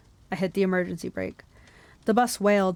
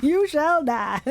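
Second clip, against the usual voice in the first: higher, hammed-up Southern accent; 7 semitones higher, 4 dB louder, much slower than usual. Reader joking as the monster teacher, mock Southern accent. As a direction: play a mock Southern threat for laughs. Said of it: "Imagine a southern accent being like".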